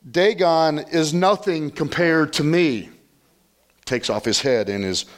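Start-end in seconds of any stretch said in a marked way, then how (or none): 0.1-2.9 high, slow, emphatic declaration; voicing God. God to the Philistines after a second fall, emphatic declaration. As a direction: proclaim it slowly as God asserting supremacy. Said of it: "God comes again a second time".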